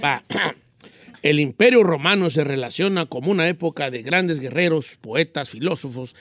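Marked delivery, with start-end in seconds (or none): 1.2-6.1 low, controlled neutral Spanish; neutral accent. Shown as a demonstration. Pupil showing off the new skill, neutral accent. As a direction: neutral accent reading, endings kept from singing. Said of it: "Sí me salió".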